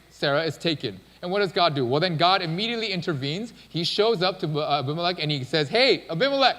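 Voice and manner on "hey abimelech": raised, louder call to attention; forceful God. Retold or imitated. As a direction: call him out sharply as God confronting him. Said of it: "God is super forceful".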